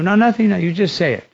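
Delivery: acted-out persuader, pitched well up; eager insistence. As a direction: brush away his worry, urging him on. Sannyasi urges the reluctant washerman.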